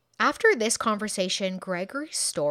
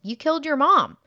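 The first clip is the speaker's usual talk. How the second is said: raised, higher, louder mock accusation; loud blunt accusation; 8 semitones higher, 9 dB louder than usual. Sergeant springs the accusation on Gregory, loud. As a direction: throw the accusation hard. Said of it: "Pagliari is just like".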